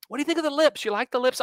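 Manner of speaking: high, prim presenter voice mimicked; mock TV host. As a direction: ask it like a polite show host. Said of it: "she goes through this whole thing".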